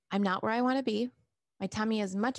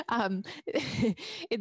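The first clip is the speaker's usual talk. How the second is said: laughing; 7 semitones higher than usual. Real, not acted. Amused by a viewer's name.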